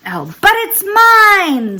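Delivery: slow, high, loud scream; screamed claim. Acted. Maisie screams at Horton for her egg, a slow high scream. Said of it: "screamed the bird".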